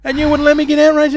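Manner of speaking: high, aggrieved whine; playful aggrieved whine; mock complaint. Real, not acted. Complaining to co-host.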